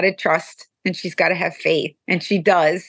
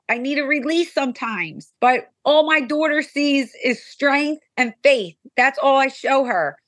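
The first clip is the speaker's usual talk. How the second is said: usual speaking voice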